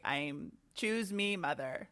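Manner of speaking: slow, soft, creaky vocal fry. Retold or imitated. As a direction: drag the words out on a fry. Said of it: "channel Ellen Pompeo's vocal fry".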